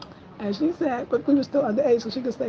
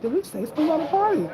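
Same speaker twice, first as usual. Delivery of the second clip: innocent excitement; 7 semitones higher, faster than usual. Retold or imitated. Kids answering mom, playing innocent.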